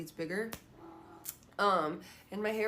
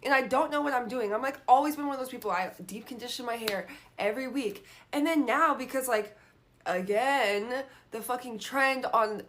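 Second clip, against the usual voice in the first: normal talking voice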